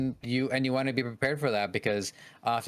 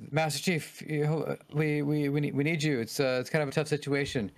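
higher, hesitant officer voice; nervous game officer; 5 semitones higher than usual. Acted. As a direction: stammer like an awkward tutorial officer. Acting out game tutorial NPC.

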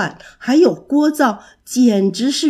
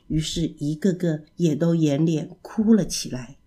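usual speaking voice

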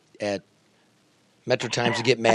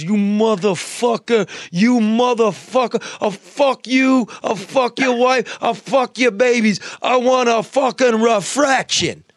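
high, loud, slow mock mobster tirade; mobster rant; 9 semitones higher, 6 dB louder, slower than usual. Retelling the mobster's furious phone call, loud slow shout. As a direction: roar it out like an enraged New York mobster. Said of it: "He calls up, he goes".

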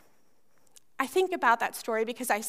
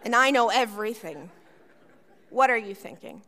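put-on teenage disdain; 5 semitones lower than usual. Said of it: "the look that every teenager masters".

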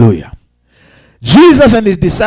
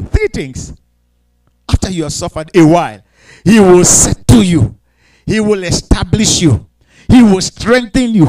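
normal talking voice

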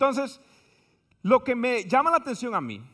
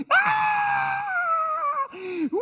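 high yelping cries; mock screams of fear; much slower than usual. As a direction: scream like riding a roller coaster. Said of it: "La gente iba".

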